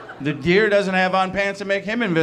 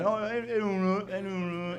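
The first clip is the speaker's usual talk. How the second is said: slow, soft, high British gibberish; mumbled mock British; unintelligible British accent; 5 semitones higher, 7 dB quieter than usual. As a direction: smear the words into British mush. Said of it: "I had no idea what they were saying".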